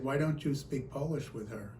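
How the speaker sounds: ordinary delivery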